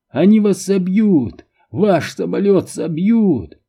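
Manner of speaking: high, agitated cry; frantic warning. A narrator's reading. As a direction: cry out a warning, dragging out the last words. Frantic warning to his captors. (отчаянный крик-предупреждение)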